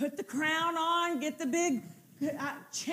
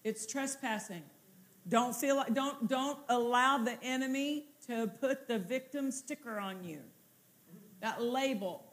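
normal talking voice

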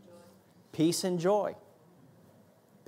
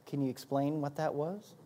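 God's soft question; 5 semitones lower, 10 dB quieter than usual. Retold or imitated. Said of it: "And he's".

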